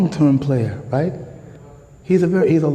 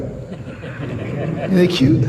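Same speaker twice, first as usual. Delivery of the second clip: mock cooing; 2 semitones higher, much slower than usual. Mock cooing over baby roach.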